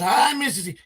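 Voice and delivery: high squeaky cat greeting; Miss Kitty voice. Pretend. Cat greeting Mister C in a high voice. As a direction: speak as the little cat, high.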